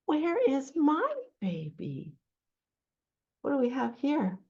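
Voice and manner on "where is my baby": sheep character. Acted. Voicing sheep in picture book.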